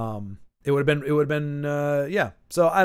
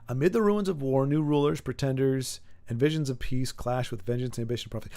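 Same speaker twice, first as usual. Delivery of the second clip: low, softening narration voice; dramatic read-aloud; 4 semitones lower, 6 dB quieter than usual. Acted. Host reads an epic summary aloud, low narration. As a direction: read it like a fantasy prologue, trailing lower.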